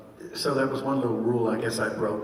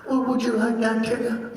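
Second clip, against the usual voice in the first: higher, gentle cartoon bear voice; Pooh's voice; 8 semitones higher than usual. Shown as a demonstration. Showing fans how he voiced Pooh to Tigger, gentle bear voice. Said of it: "when I started ... I would do it back and forth".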